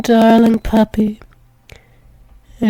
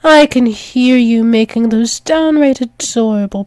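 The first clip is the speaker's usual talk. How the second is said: ordinary talk, nothing marked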